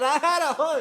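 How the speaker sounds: very high, loud punchline; hammed-up vindication. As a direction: burst out high and loud through laughter. Comic punchline reply amid laughter.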